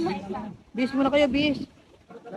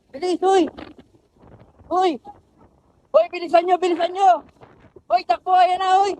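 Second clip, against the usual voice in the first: loud urgent shouts while running; 6 semitones higher, 5 dB louder than usual. Acted. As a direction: call out as you run. (sumisigaw habang tumatakbo)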